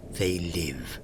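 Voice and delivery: storybook ending. A narrator's reading. Story's closing line.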